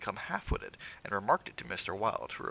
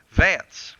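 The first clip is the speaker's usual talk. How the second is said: loud summons into the dark passage; loud call; 9 semitones higher, 15 dB louder than usual. A narrator's reading. Master calling his servant from the study, loud call. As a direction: call the name out loudly, high. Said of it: "called out in a loud voice".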